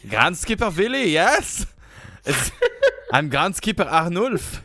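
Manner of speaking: put-on high, loud German-accented voice; hammed-up German accent; mock German accent. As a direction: play a German groundskeeper. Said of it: "I'm just German".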